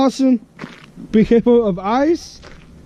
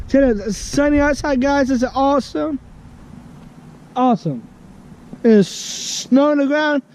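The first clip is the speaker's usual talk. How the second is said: higher, bright delight at sunshine; cheered up; 7 semitones higher than usual. Real, not acted. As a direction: perk up after the tears. Cheering up after crying.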